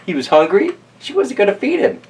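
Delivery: ordinary delivery, nothing marked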